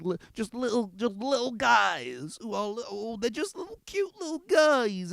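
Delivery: high, cooing mockery of cute little guys; mock cutesy voice. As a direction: coo it like you're mocking a cute mascot. Mocking cute little characters.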